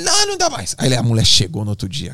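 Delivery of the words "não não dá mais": very high mock refusal; squealed, hammed-up protest; exasperated mother. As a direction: imitate a mother who has had enough. Retold fed-up mother refuses.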